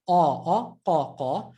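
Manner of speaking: ah, ah, qa, qa said with a round, open, full back sound; teacher modelling the rounded qa; rounded qaf sound. Shown as a demonstration. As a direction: round the mouth on qa. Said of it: "the rounded sound".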